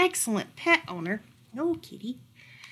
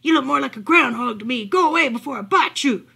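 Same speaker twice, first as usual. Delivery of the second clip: loud, fast, aggressive dog; yelling threat; 2 semitones higher, 7 dB louder than usual. A narrator's reading. Big dog sending the little dog away, yelling. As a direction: yell it as a quick nasty warning. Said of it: "had never heard such yelling".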